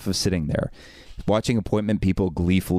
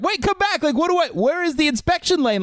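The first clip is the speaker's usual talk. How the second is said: very high, frantic shout after someone; panicked calling. Retold or imitated. Locked-out customer calling back the departing helper, panicked. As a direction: act out desperate calling through a locked door.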